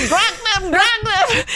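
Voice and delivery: high, loud hammed-up command; angry mock yell. Bodywork woman raging at her kicking client, high mock yell. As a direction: imitate a furious instructor yelling. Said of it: "did not shrink from displaying anger".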